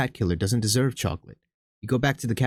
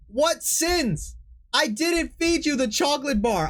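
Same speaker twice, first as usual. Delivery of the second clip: indignant protest; slower than usual. Real, not acted.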